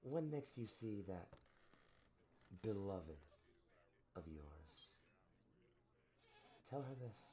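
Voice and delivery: measured, menacing parting words with long pauses; lightly voiced fallen villain. Villain's parting speech in game.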